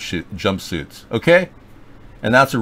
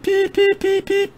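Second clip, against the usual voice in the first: very high bleeping, voiced like a censor tone; squeaky censor bleeps; slower than usual. Host censors his own swearing with squeaky voiced bleeps. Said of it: "son of a beep".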